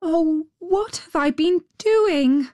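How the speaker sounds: put-on high, drawn-out little girl's voice; slow, high-pitched dismay as a young girl. A narrator's reading. Little girl remembers she should seek her friend, high and slow. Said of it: "said the little girl".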